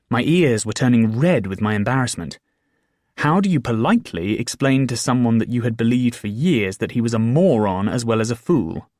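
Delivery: ordinary delivery, nothing marked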